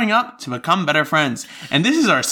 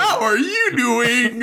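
put-on creepy child voice; 6 semitones higher, slower than usual.

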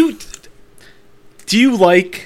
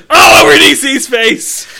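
laughing shout; 10 semitones higher, 6 dB louder than usual. Real, not acted. Laughing outburst at friend's story.

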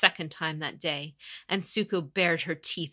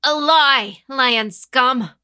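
much louder, higher angry shout; furious outburst; 8 semitones higher, 17 dB louder, much slower than usual. Acted. Defiant youth shouting at the conqueror, furious and high. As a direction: spit the insult at her. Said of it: "Ichiro hissed".

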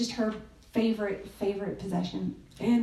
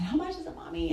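put-on little girl asking; 6 semitones higher, 5 dB quieter, faster than usual. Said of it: "and she said".

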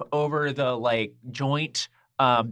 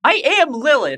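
higher and louder, stamping her name down; insistent declaration; 11 semitones higher, 8 dB louder than usual. Acted. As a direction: make sure they know who you are.

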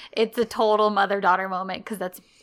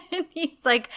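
usual speaking voice

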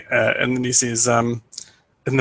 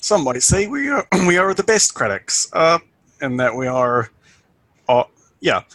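put-on folksy rural accent; 4 semitones higher than usual. [name] folksy, higher.

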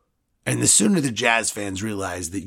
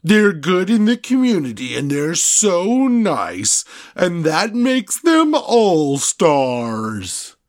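higher, louder, slow sarcastic sneer; mocking; 5 semitones higher, 6 dB louder, much slower than usual. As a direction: drag it out mocking their niceness. Mocking rival players' image.

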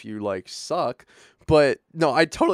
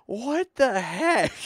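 high, slow disbelief; raised-pitch mock shock; baffled reaction; 10 semitones higher, much slower than usual. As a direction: stretch it out, utterly bewildered. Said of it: "I'm like".

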